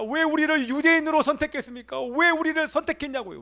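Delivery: impassioned, high-pitched outcry; acted anguished plea, pitched high; crying out to God. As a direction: cry out in protest to God. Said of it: "이렇게 하나님 앞에 부르짖고 외칩니다".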